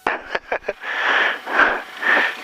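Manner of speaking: laughing. Real, not acted. Pilot laughs in cockpit.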